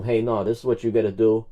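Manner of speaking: ordinary talk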